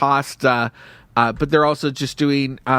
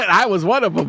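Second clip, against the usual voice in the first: laughing; 5 semitones higher than usual. Real, not acted. Joking while laughing.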